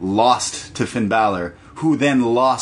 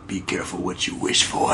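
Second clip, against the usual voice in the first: ominous whisper; 5 semitones lower, 6 dB quieter than usual. Retold or imitated. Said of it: "He says".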